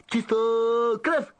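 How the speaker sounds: rising loud exclamation; Malfoy remembering the password; exclaiming. Acted. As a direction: blurt out the answer triumphantly.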